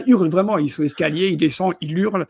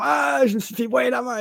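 mock panic cry; 8 semitones higher than usual. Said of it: "il fait genre".

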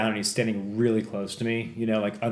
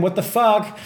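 exasperated; 10 semitones higher, 4 dB louder than usual. Retold or imitated. Acting out ignored detainees' exasperation.

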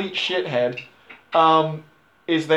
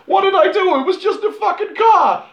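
much higher, louder whiny squeal; whiny son; 4 dB louder than usual. Retold or imitated. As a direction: whine like a bratty kid who got caught. Punched son whines to his dad.

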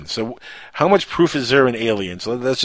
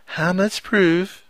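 higher, very slow put-on voice; attempted better voice; 8 semitones higher, much slower than usual. Host retries a listener's question, badly put-on high voice. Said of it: "Let me do it in a, in a better voice".

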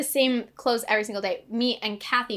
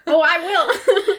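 laughing; 5 semitones higher, 8 dB louder, much slower than usual. Real, not acted. Teasing reveal of the label.

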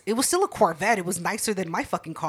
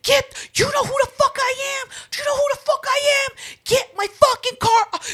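very high, loud raging yell; imitating furious shout; 5 dB louder than usual. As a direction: scream at the tow driver. Neighbor raging at driver.

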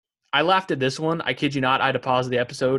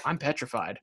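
quiet Kevin deadpan; 8 dB quieter than usual. Retold or imitated. Kevin from the back row, a soft petrified mumble.

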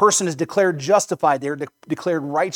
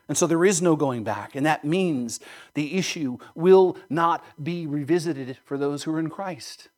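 ordinary delivery

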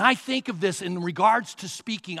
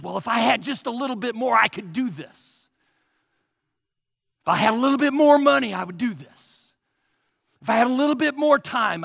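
mock excuses; 4 semitones higher, 6 dB louder, faster than usual. Preacher voicing excuses.

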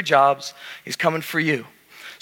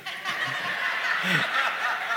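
whispered rising laugh; breathy laugh; 7 semitones higher, 9 dB quieter than usual. Real, not acted. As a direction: let the laugh bubble up after the punchline. Laughs at own joke.

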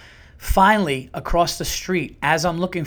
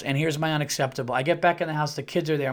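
ordinary talk, nothing marked

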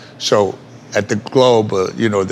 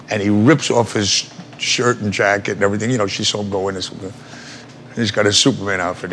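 normal talking voice

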